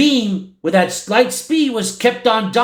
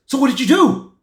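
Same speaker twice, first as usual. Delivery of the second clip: anxious question; 5 semitones higher than usual. Retold or imitated. Everybody anxiously presses Reed.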